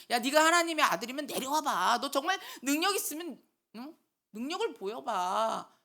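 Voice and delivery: hammed-up goading challenge; mocking jeer. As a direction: jeer like a scoffing crowd. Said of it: "이렇게 조롱하고 침뱉는".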